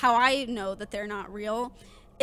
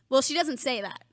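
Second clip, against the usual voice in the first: ordinary talk, nothing marked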